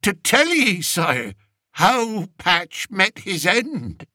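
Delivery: a humble peasant begging the king; old country shepherd. Acted. Old shepherd telling the king of his journey, rustic pleading voice.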